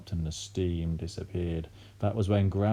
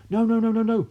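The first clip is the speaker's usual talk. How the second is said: high, very loud repeated no; family yelling as she drinks; panicked shout; 8 dB louder than usual. A narrator's reading. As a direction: scream it in panic. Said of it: "the other two cried".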